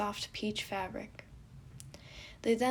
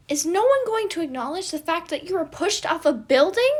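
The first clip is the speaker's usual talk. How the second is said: high, loud alarmed cry; alarmed outburst; 9 semitones higher, 13 dB louder than usual. Acted. Roman's friend alarmed he was pushed off, high and loud. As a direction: burst out in alarm.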